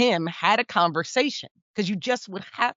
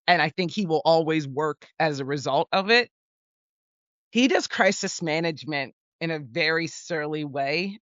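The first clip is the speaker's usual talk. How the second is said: ordinary talk, nothing marked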